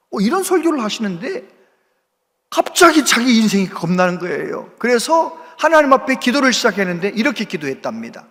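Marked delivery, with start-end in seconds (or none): none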